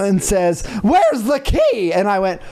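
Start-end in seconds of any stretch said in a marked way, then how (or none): 0.8-1.9 much higher, slow eager plea; eager question. Retold or imitated. Imitating the professor rushing over, high eager question. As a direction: ask like a child at Christmas.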